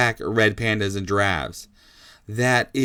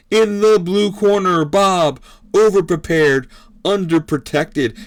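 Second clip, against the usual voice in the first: big, higher fight-night introduction; ring announcer; 5 semitones higher, 4 dB louder than usual. Acted. Announcer introducing Bob and the news to the crowd, booming showmanship. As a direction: draw out the corners like a boxing emcee.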